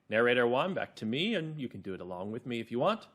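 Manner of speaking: ordinary delivery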